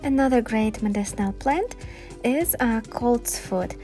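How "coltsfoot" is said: ordinary delivery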